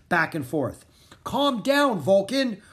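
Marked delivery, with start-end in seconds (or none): 1.2-2.6 loud high yell at the dragon. Acted. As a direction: shout to calm the raging beast. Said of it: "Rory yelled".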